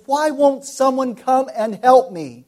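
high, loud desperate plea; pleading cry. Retold or imitated. Preacher voicing a sick prisoner's loud cry for help. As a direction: cry out for help like a trapped man.

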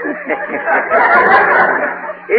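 real high-pitched giggle; host breaking up mid-sentence; sudden high giggle; high laugh. Real, not acted. Host cracks up mid-line.

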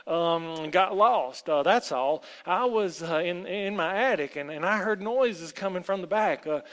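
loud, high, halting panic; terrified stammer. A narrator's reading. Terrified boy explains himself to the woman, stammering. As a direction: stammer out excuses in fright. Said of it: "Tom was terrified ... He stammered".

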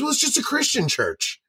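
mock the nervous young missionary; a hint of a timid door-to-door missionary. Missionary voiced in retold story.

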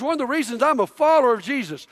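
ordinary talk, nothing marked

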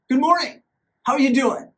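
high, loud fake-cheerful greeting; overly bright good morning to make a point; pointed polite greeting. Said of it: "So I said to her".

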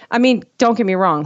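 ordinary talk

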